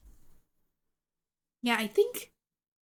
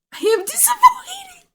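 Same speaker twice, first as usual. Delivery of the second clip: higher, louder laughing self-scold; laughing at herself; 9 dB louder than usual. Real, not acted. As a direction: laugh through the embarrassment. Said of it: "I'm covering my face".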